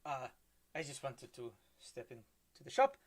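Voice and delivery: put-on German accent drifting Spanish; bad German accent. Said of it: "my German accent sound like he's fucking Spanish".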